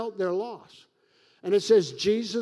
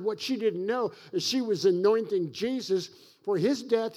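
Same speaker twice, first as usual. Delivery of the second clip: ordinary talk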